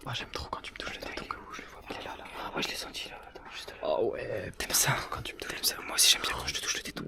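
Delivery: hammed-up seductive whisper; mock sensual whisper. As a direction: breathe it like a cheesy lover. Mock seduction joke between friends.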